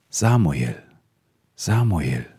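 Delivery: put-on God calls the sleeping boy by name, slow and solemn; a solemn divine call, unhurried. God calls young Samuel in the night, solemn calling voice. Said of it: "Da rief der Herr".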